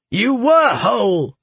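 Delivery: heated, emphatic contradiction; anguished outburst. A narrator's reading. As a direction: burst out at a son in anguish. Anguished father contradicts his son.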